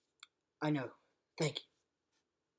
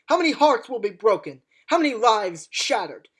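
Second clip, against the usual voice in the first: loud, impassioned speech building to a shout; rally them, louder and louder; booming rousing general speech; 3 semitones higher, 5 dB louder than usual. Acted. Doctor's rising speech about war.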